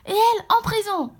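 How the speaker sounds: loud, high-pitched acted command; angry order. Angry character sends the newcomer to prison, commanding shout.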